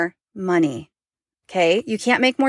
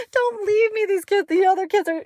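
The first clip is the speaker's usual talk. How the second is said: mother playfully begging her daughter not to leave; half-crying plea; 13 semitones higher than usual. Retold or imitated. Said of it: "I tell Jamie all the time, I'm like".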